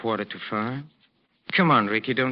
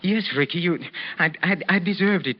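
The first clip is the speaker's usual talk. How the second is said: higher-pitched, stammering self-blame; distraught remorse; 5 semitones higher than usual. Acted. Maestro confessing guilt to his old fighter, distraught. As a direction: stumble over the confession, pitch raised. Distraught apology for deserving death.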